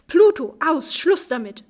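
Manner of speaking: loud, high scolding of a dog. A narrator's reading. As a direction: tell the dog off sharply. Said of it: "schimpfte Venus".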